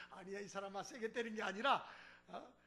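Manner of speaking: ordinary delivery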